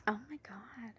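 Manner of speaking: hushed, whispered mock shock; sussurro scandalizzato. Mock shock at friend's news.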